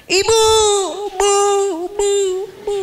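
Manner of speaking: high, loud, tearful calling of mother; lost child shouting for his mother; child yelling. Acted. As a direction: scream for mom from far off. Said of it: "pakai teriak-teriak juga nama".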